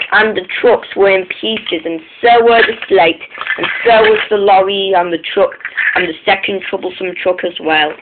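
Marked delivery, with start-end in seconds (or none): none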